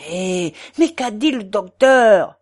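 put-on loud German-accented burst of impatience; enraged. A narrator's reading. German friend stamping his foot at the portress, enraged. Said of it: "demanda rageusement Schmuck".

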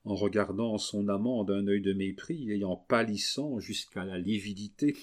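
usual speaking voice

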